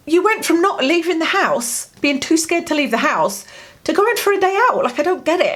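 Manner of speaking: baffled daughter lays it out; high incredulous plea to her dad; exasperated disbelief. Retold or imitated. Exasperated daughter quoting herself.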